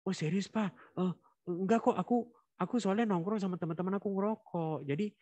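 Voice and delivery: put-on high, stammering kid caught out; high nervous child voice fumbling for an excuse; flustered child. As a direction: stumble over the excuse like a nervous boy. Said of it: "jawaban anak yang gelagapan".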